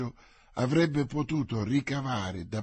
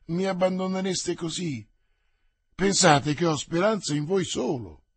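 a mildly whining plea, pitched higher; plaintive; 5 semitones higher, 4 dB louder than usual. A narrator's reading. Frightened servant Planchet begging his master to stay, mildly plaintive. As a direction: beg him not to leave you. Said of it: "in tono lamentevole".

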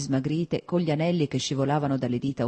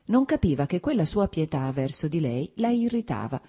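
ordinary delivery, nothing marked

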